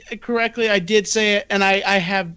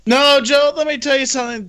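raised voice, high and loud; heated interjection; 6 semitones higher, 5 dB louder, faster than usual. Real, not acted. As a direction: cut in forcefully to take the floor.

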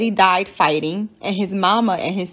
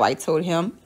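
plain reading voice

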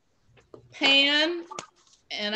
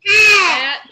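loud cheer; 7 semitones higher, 13 dB louder than usual. Real, not acted. Teacher echoes child's answer.

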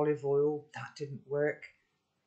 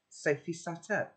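usual speaking voice

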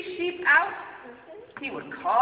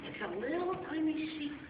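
ordinary delivery, nothing marked

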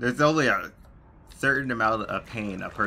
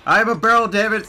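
furious yell, much higher and louder; angry shout; 13 semitones higher, 12 dB louder than usual. Real, not acted. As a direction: scream it in frustration. Frustrated shout while hiding.

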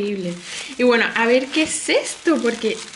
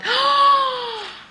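high, loud gasp of delight; 12 semitones higher, 3 dB louder than usual. Real, not acted. Overwhelmed by a follower's gift, high delighted gasp. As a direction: gasp in real amazement.

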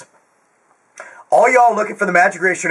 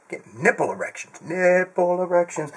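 muttered aside; 5 semitones lower, 7 dB quieter than usual. Real, not acted.

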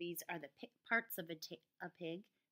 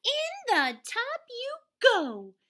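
high, loud, slow command; march them into the bath; firm scolding housewife; stern order; 13 dB louder, slower than usual. A narrator's reading. Mrs Wishy Washy orders animals.

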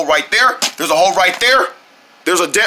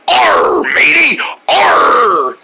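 big growled pirate arr; pirate captain; 4 dB louder, much slower than usual. Acted. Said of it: "like a captain pirate".

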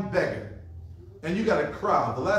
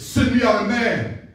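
a loud, higher shouted challenge; Goliath's taunt; 4 semitones higher, 8 dB louder than usual. Acted. Giant shouting to the army, loud challenge.

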